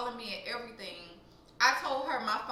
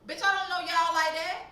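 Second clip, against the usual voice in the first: loud, higher-pitched protest; indignant retort; 6 semitones higher, 8 dB louder than usual. Retold or imitated. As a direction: push back loudly, all worked up.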